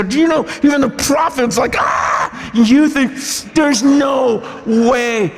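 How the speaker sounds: impassioned preaching. Retold or imitated. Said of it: "I go".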